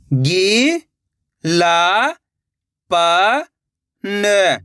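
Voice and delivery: "गी… ला… प… न", each held alone; stretched teaching syllables of "गी ला प न"; syllable-by-syllable reading. Shown as a demonstration. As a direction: sound out "गी ला प न" with long pauses. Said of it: "एक एक अक्षर का उच्चारण".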